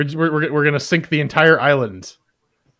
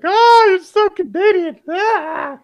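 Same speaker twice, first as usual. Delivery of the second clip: high, loud, drawn-out mock squeal; mocking imitation; 7 dB louder, slower than usual. Host mimics people reacting to his Zed, high mocking squeal. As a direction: tease in an over-the-top high-pitched wail. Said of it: "They're like".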